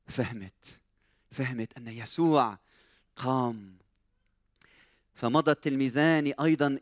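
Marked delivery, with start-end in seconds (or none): 0.1-3.8 low, soft dawning understanding; hushed realization. Acted. Preacher voicing Mary realizing the resurrection, hushed and low. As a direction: let it sink in quietly.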